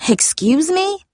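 high, affronted question; indignant, pitch shooting up; snapped retort. A narrator's reading. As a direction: throw it back sharply, offended. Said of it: "she snapped".

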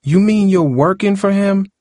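high, incredulous question; high-pitched shocked realisation; disbelief. A narrator's reading. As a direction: ask it as if you can't believe it. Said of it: "eyes widened with disbelief".

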